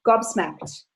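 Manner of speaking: normal talking voice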